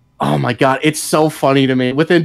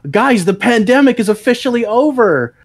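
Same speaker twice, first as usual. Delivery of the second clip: a hint of a pompous press-briefing voice, higher; mock official announcement; 6 semitones higher than usual. Mocking the government, a lightly voiced pompous announcement. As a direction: lightly play the government declaring it over.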